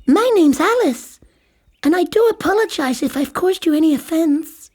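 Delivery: put-on high, prim little girl voice; high girlish Alice, politely apologising. A narrator's reading. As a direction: play a polite, proper young Alice.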